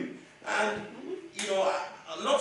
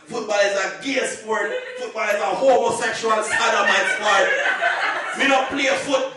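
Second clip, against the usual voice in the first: a hostile Jamaican dad scolding his daughter's fiancé; Jamaican father; 6 dB louder than usual. Retold or imitated. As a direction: growl it like a scary Jamaican dad. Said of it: "I'm like".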